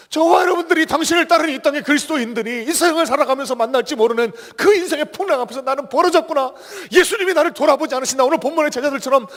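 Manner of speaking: preaching at full force, high and loud; 열정적인 설교. Acted. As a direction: drive the point home to the congregation. Preaching climax.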